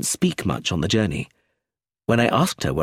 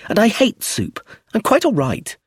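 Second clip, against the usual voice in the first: put-on high-pitched child refusing; protesting child; 11 semitones higher, 4 dB louder than usual. A narrator's reading. Child turns down her mother's soup, high protest. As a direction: protest in a high little-girl voice.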